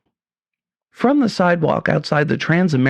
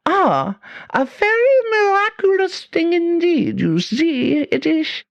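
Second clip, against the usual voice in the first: very high, slow, hammed-up German accent; fake German accent; slower than usual. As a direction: play the mad German professor, thick fake accent. German professor continues.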